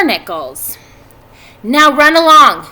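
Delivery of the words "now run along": parent sending off. A narrator's reading. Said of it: "he said".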